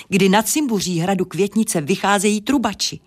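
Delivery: usual speaking voice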